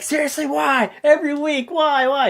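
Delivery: high-pitched, mock pleading complaint; exasperated whine. Complaining about the same guys every week, mock exasperated wail.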